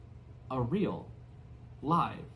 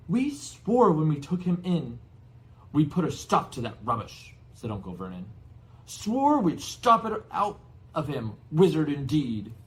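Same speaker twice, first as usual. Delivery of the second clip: loud, high, spluttering fury; angry uncle; 4 semitones higher, 9 dB louder than usual. Acted. Uncle furiously tells the giant they fought it. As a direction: spit out each word in disgust. Vernon voiced angrily.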